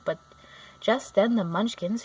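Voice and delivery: plain reading voice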